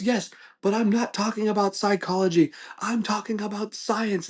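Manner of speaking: high-pitched mock British argument; heated British debater. British-accented customer correcting his friend, heated. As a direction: imitate an indignant British debater, stressing the point. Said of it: "they're, like, yelling at each other".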